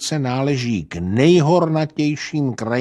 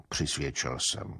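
ordinary delivery

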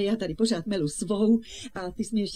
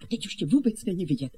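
ordinary talk

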